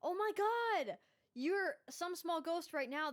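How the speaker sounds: high, loud delighted cry; excited. Real, not acted. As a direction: burst out with real joy. Excited greeting of new guest.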